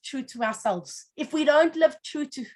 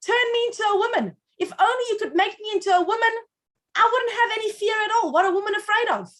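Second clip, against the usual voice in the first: higher-pitched acted plea to God; pleading prayer; 7 semitones higher than usual. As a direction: beg earnestly, pitch lifted throughout. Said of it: "turns to God and says".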